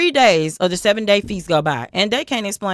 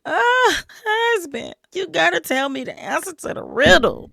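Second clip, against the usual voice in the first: high whiny pleading wail; mock crying wife; 9 semitones higher than usual. Wife begging Samson for the riddle, high mock cry. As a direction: whine it like a wife faking tears. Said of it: "she was crying".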